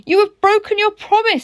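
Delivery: high, loud wounded accusation; hurt caterpillar. Acted. Caterpillar scolds tadpole for broken promise, hurt and loud. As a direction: cry out the betrayal as the caterpillar.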